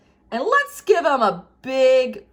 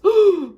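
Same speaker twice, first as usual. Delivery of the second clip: gasp; 9 semitones higher, 9 dB louder than usual. Shown as a demonstration. Drawing a fearful face.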